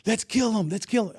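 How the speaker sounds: normal talking voice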